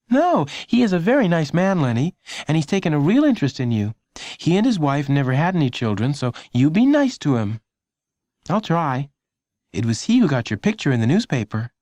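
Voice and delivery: a mom telling her son to be nice; lightly voiced mother; mother reassuring. A narrator's reading.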